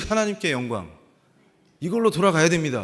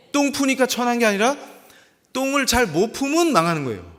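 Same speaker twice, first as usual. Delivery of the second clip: loud, high, driving sermon delivery; preaching at full force; 6 semitones higher, 7 dB louder than usual. Acted. Preacher insisting any job done for God is noble, impassioned preaching. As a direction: press every question on the congregation, voice raised.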